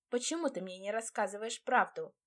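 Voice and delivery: ordinary delivery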